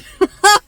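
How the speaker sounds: a high, loud burst of laughter. Real, not acted. Laughing at crashing the wrong party, loud high laugh. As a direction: let the laugh explode out.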